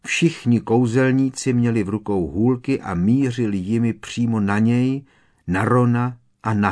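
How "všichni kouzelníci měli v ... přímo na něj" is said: plain reading voice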